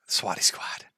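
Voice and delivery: breathy, slow, soft murmur of the slogan; sighed whisper. Acted. Streamer muttering his new slogan to himself, whispered. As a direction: whisper Swatty Squad to yourself with a sigh.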